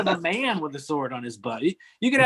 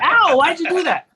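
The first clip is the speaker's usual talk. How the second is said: hurt yelp; 10 semitones higher, 6 dB louder than usual. Retold or imitated. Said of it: "It's like".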